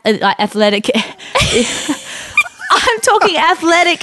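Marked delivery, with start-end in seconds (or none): 1.0-4.0 high breathy laugh into words. Real, not acted. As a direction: laugh through the correction. Laughing at own slip.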